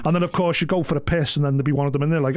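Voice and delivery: ordinary delivery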